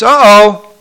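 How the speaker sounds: high, loud mock alarm; sudden playful dismay; uh-oh reaction. Shown as a demonstration. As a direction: exclaim it as if something just went wrong. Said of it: "Accidents means".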